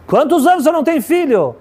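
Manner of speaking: high-pitched, insistent repeated question; acted urgent demand; urgent insistence. Retold mother urgently repeats her question.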